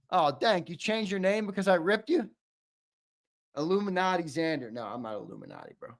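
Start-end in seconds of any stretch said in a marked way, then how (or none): none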